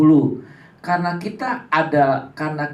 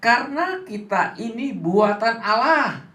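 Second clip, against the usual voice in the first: higher, cue the class to read along; leading the reading; 8 semitones higher than usual. Shown as a demonstration. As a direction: start the children off. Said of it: "Dua, tiga". (memandu anak membaca bersama, nada lebih tinggi)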